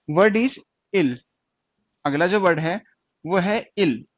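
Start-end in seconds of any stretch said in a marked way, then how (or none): 0.1-1.2 slow dictation. Shown as a demonstration. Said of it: "एक word है इल".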